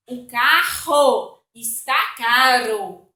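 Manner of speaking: "carro" with a throaty R, "caro" with a tapped R, slowly; slow, loud demo: "carro" throaty against "caro" tapped; guttural R in "carro", flicked R in "caro"; contrast R sounds. Shown as a demonstration. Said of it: "dois R's dão força".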